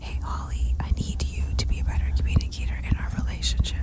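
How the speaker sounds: fast unvoiced whisper. Shown as a demonstration. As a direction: breathe it right into her ear. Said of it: "whispering into your ear".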